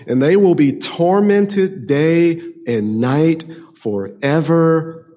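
loud, ringing preaching of the verse; forceful scripture reading. Acted. Preacher thundering the verse at his congregation, loud and ringing. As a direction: proclaim it like a judgment.

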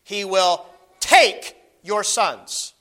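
"take" drawn out and weighted; stress on take. Shown as a demonstration. Preacher reading Samuel's warning, slow stress on "take". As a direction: slowly lean on "take". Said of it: "I'm gonna emphasize a word".

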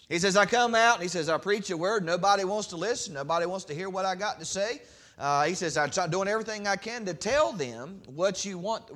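loud, high impassioned preaching of the prophet's lament; preaching at full force. Acted. Preacher voicing Jeremiah's complaint to God, loud impassioned preaching. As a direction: cry it out from the pulpit, pleading with God.

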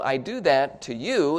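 ordinary talk

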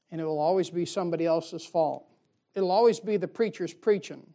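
ordinary talk

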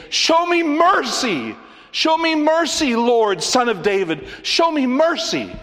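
high, loud, pleading cries; desperate yelling. Acted. Woman crying out to Jesus, loud high pleading yell. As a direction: cry out again and again for mercy. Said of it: "she was, brace yourself, yelling".

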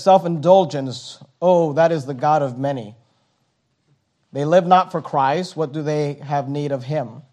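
subdued, deliberate pulpit reading; solemn quotation reading. Acted. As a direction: read it gravely and quietly. Reads out a quotation.

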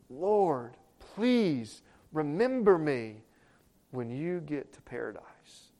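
slow, drawn-out pleading; desperate plea. Shown as a demonstration. Thief on the cross begging the Lord, slow earnest plea. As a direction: beg him, stretching each word. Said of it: "You think that's how he said it?".

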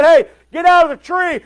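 preacher shouting Jesus's command up the tree; urgent shout. Acted. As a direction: call him down from the tree right now. Jesus calls out, shout heard.

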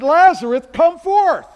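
high, loud, authoritative command; Jesus commanding Lazarus, thunderous call; commanding shout. Shown as a demonstration. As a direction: order the dead man out with full force. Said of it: "He said".

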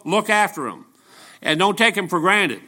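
usual speaking voice

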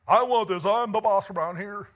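high, loud mock bossy voice; sneering imitation of a selfish boss; mock entitled boss. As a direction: play the grabby modern man. Mock modern selfish attitude.

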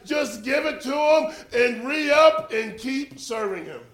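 the cloud of witnesses cheering believers on. Retold or imitated. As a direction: urge a runner on from the stands. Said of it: "And they're like".

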